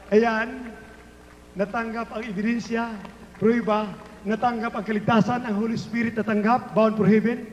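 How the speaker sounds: normal talking voice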